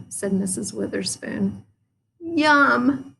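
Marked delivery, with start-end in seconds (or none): 2.2-3.1 eager cry. A narrator's reading. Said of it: "cried Froggy".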